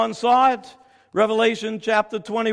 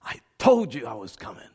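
much lower, slow, gentle; tender voice of God; 8 semitones lower, 3 dB quieter than usual. Acted. God answers His searching people, tender low reply. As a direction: answer softly and lovingly.

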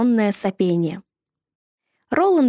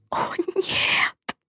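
frightened whisper; 10 semitones higher, 4 dB quieter than usual. Acted. Boys see danger on stairs.

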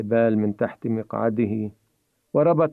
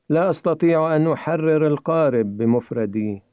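ordinary delivery

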